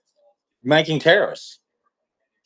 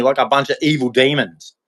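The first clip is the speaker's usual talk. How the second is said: normal talking voice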